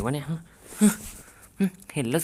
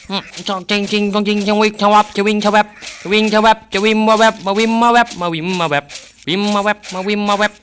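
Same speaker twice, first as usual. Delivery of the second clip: higher chanted swish noises; mock sword swishes; 6 semitones higher than usual. Gamer chanting sword swish sounds while fighting. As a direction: sing the blade sounds playfully.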